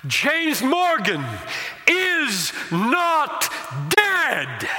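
high, loud ringing proclamation; magisterial cry. Retold or imitated. Funeral preacher crying out to the mourners, loud and majestic. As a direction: cry it out over the congregation, majestic. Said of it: "in his magisterial voice, lifted it up and cried out".

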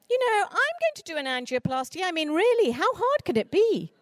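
high, airy know-it-all voice; mock breezy boast. Imagined person telling a surgeon, in a high airy boast. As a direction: play the fool who thinks surgery is easy.

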